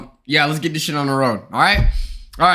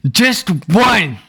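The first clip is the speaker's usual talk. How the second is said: 1 pleading; 4 semitones higher, 4 dB louder than usual. Acted.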